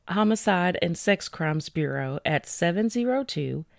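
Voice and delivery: usual speaking voice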